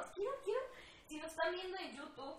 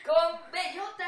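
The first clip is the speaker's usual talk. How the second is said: slow, much louder joking shout; 5 semitones higher, 16 dB louder, much slower than usual. Real, not acted. Friend mocking her friend with a cartoon joke, loud.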